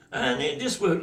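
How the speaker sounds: usual speaking voice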